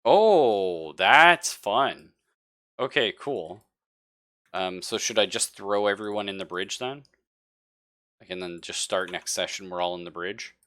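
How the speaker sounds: ordinary talk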